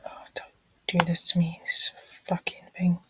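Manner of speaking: whispered swearing under breath; muttered curse. Real, not acted. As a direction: curse quietly at the screen. Swearing at a glitching site.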